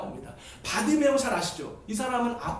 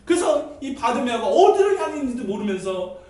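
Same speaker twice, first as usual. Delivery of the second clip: normal talking voice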